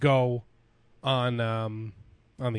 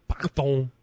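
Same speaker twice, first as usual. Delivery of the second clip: bad French accent.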